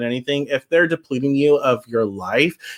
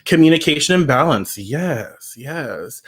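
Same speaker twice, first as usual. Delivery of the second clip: ordinary talk, nothing marked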